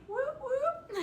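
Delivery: very high double whoop; playful party cheer, way up high; celebratory whoop. Real, not acted. Cheering a viewer's compliment.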